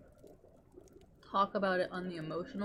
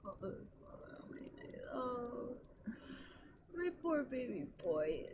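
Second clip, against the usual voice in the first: tender coo; 4 semitones higher, 5 dB quieter than usual. Real, not acted. Gushing over her baby boy.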